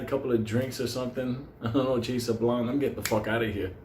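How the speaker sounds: ordinary talk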